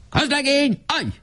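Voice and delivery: high barked military order; rasping command. A narrator's reading. Lieutenant Weiss orders the private to halt, commanding shout. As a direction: bark the halt like an officer. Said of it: "Recsegő hangon kiáltott".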